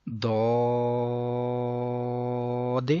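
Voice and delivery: داد with a long rounded "aw" and bounced final d; heavy elongated daad. Shown as a demonstration. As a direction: sustain داد full-mouthed, release the last d. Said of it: "بلکہ داد". (داد موٹا اور لمبا)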